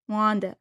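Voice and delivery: WANDERED said as "wandit"; imitated pronunciation. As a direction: drop the R in WANDERED, short "-it" ending. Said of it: "she's saying, like".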